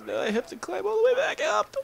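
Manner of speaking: mock crying whine; whiny fake cry. Real, not acted. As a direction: whine like you're about to cry. Annoyed climbing back up.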